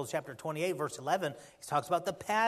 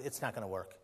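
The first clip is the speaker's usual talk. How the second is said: low, quiet voice of giving up; discouraged doubter; 6 semitones lower, 8 dB quieter, faster than usual. Acted. Preacher voicing a doubter's thoughts, low discouraged resignation. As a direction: sink down as if already beaten. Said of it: "You can kinda start thinking".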